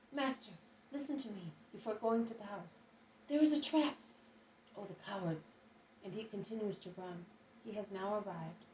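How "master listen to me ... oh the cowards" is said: breathless urgent warning, higher and louder on the trap; a hint of a choked shout. A narrator's reading. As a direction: gasp out the warning. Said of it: "is able to shout in a choked voice".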